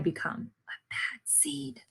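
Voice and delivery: dramatic hushed whisper. A narrator's reading. Teacher reading the book to children, whispered reveal. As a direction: breathe it out like a dark secret.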